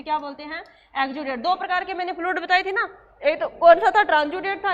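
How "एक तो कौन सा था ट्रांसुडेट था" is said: laughing through the words, louder. Real, not acted. Teacher laughs asking students about fluids, laughing. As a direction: burst out laughing while asking.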